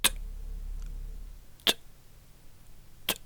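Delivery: "t" popped hard with a glottal release, no puff of air; ejective t. Shown as a demonstration. Teacher demonstrating the ejective "t" of the letter Tet. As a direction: click out each "t" short and tight. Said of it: "an ejective T sound".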